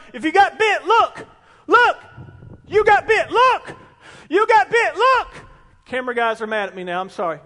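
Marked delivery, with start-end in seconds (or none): 0.1-5.3 loud, high urgent shouts of look; Moses shouting. Retold or imitated. Moses holding up the rod to the people, urgent shouting. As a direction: cry out to the bitten crowd, rising on each look. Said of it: "he's holding up and going".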